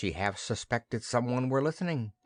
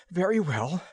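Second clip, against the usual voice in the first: heavy breath; 8 semitones higher, 5 dB louder than usual. A narrator's reading. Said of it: "he breathed heavily".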